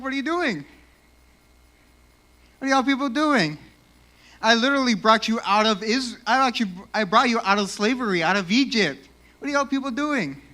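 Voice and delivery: high-pitched exasperated scolding; angry God. Acted. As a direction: scold them like a let-down God, acted. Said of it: "God's gonna be angry about that".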